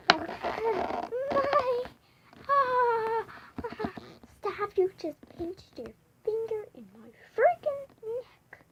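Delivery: high, loud yelp of pain; pained cry. Real, not acted. Neck pinched by the other one's finger, pained cry.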